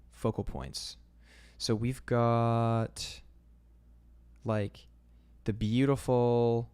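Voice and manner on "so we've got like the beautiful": slow, loud stretched words; slow drawn-out. Real, not acted. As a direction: stretch each word out admiringly. Drawn-out admiring description.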